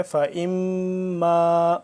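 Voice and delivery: slow tajweed فَإِمَّا with nasalized, sustained m; ghunna on meem. Shown as a demonstration. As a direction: chant فَإِمَّا holding the doubled m as a nasal hum. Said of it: "میم مشدد کی وجہ سے یہاں پہ غنہ ہوگا".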